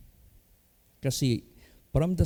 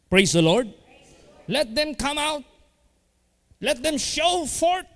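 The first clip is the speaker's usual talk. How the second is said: rising preaching; 12 semitones higher than usual. Real, not acted. Pastor urging the church to shine, building to a high exhortation. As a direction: pitch climbing higher and higher as the exhortation builds.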